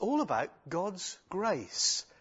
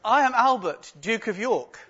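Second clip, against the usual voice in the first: put-on higher, louder boy prince; a light, higher boy's voice; little prince; 6 semitones higher, 6 dB louder than usual. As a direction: pipe up proudly like a small royal. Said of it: "The second one goes".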